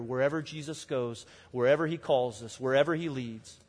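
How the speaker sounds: ordinary delivery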